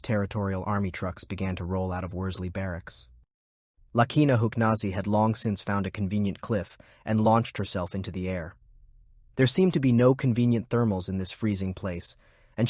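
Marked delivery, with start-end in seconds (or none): none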